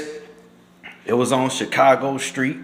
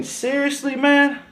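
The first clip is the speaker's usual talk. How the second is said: incredulous; 12 semitones higher than usual. Real, not acted. Disbelief at the officers.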